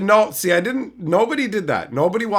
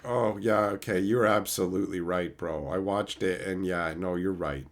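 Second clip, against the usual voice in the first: grudging admission; 9 semitones lower, 7 dB quieter than usual. Retold or imitated. Commenters grudgingly admitting he was right.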